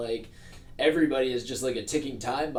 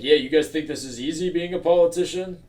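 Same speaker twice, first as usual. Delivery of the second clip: loud, raised politician voice; 6 semitones higher, 8 dB louder than usual. Acted. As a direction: play a defensive politician. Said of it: "I love your politician voice".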